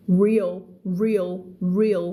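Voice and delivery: REAL with a clear L, tongue rolled; tongue curled back. Shown as a demonstration. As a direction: model REAL slowly, never ending in a W. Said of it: "เสียงลิ้น ลิ้นมันจะม้วนอยู่ข้างหลัง".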